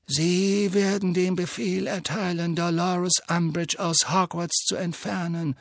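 put-on calm, measured old wizard; Dumbledore voice. A narrator's reading.

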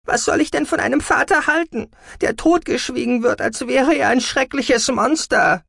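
high, loud, anguished accusation; shouted, exasperated demand; furious outburst. A narrator's reading. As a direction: rage at mother, pitched right up. Daughter rages about silenced father.

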